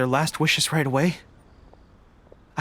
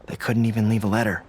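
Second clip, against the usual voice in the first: low, somber remembrance; 6 semitones lower than usual. Acted. General tells the traveler of her fallen soldiers, low and somber.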